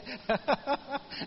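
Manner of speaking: high, choppy chuckle; amused laugh. Real, not acted. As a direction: burst out laughing at your own remark. Laughs at own blunt remark. (cười khoái chí)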